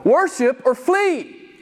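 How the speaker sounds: high, drawn-out ultimatum; emphatic proclamation. Acted. Preacher giving the Bible's message to his congregation, high emphatic proclamation.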